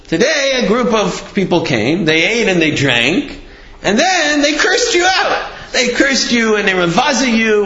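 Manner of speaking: animated acting-out of scandalous news; dramatic retold report. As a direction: relish the bad news, pitch leaping on the cursing. Family dramatically reports the cursing.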